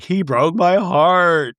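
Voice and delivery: hammed-up weepy lament; mock crying. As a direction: imitate a heartbroken wail, stretching the words. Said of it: "Lisa is crying again".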